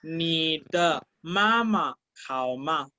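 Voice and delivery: ni de mamá hao ma said slowly, syllable by syllable; slow model phrase. Shown as a demonstration. Teacher modeling a Chinese phrase for students, slowly. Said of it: "Si yo digo".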